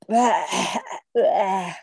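loud bleating bleh of disgust; disgusted "bäh". A narrator's reading. Little vampire with a mouthful of fur, retching bleh. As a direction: spit out a revolted bleh, twice. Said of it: "machte er".

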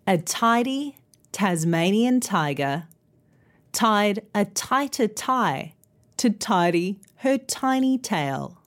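careful, phrase-by-phrase tongue twister with pauses; slow tongue twister. Shown as a demonstration. Teacher modelling a tongue twister for learners, slow and careful. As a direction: take it slowly so learners can follow. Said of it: "let's try that tongue twister".

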